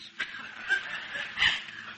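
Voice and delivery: a short, soft breathy laugh; amused laugh. Acted. Woman amused by the visitors' accents, soft breathy laugh. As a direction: laugh lightly at the Americans.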